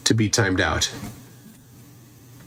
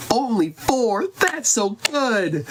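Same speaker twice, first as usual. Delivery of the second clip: high, stretched-out praise in a put-on voice; mock impressed; 10 semitones higher, much slower than usual. Golfing guy praises her for only four boxes, mock impressed. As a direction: gush with pretend amazement, pitched way up.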